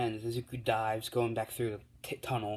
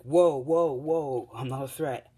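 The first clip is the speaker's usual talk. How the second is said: loud, raised "whoa" easing off; alarmed protest; 4 semitones higher, 9 dB louder, slower than usual. Retold or imitated. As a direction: blurt it out to stop someone, then reassure. Hands up, caught in the cave.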